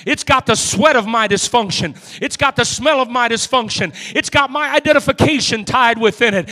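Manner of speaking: normal talking voice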